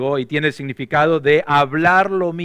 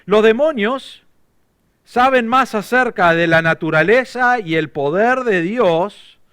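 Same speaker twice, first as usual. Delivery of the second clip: higher and louder, driving the point home; emphatic preaching; 8 semitones higher, 6 dB louder than usual. Acted. Preacher telling the church about demons, emphatic. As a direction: preach it with rising force.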